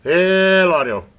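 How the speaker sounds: loud, drawn-out radio test call; calling out to check the transmit audio; mic check call. Real, not acted.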